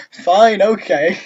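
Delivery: high, loud giving-in; loud resignation. Real, not acted. Reader gathers themselves after laughing, loud resignation. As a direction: blurt it out, pitched right up.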